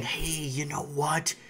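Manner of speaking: soft, slow, calming media voice; mock reassuring news anchor; soothing mock reassurance. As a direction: hush them like a smooth spokesman saying all is fine. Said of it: "You see the article saying".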